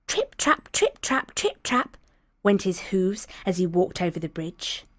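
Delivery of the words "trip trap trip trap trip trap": light hoof steps. A narrator's reading. Youngest goat's little hooves crossing the bridge, high and light. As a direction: tap it out like tiny hooves.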